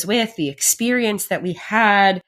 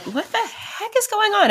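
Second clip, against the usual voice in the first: bewildered. Retold or imitated. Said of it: "broken and scared".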